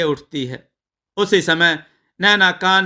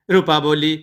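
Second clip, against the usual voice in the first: ordinary delivery, nothing marked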